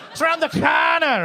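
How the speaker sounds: high, loud Scouse squawk; cat-like Scouse yowl; mock Scouse accent. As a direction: screech it as an exaggerated Scouser. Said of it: "Scousers, your accent".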